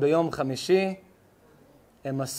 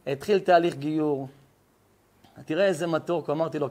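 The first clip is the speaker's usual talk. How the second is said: usual speaking voice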